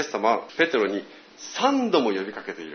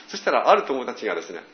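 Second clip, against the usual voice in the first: ordinary delivery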